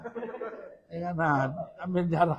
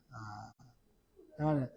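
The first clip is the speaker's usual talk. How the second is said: ordinary talk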